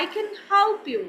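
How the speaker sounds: imitated native English accent. Shown as a demonstration. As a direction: put on an English speaker's style. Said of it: "अंग्रेज़ों का style adopt किया".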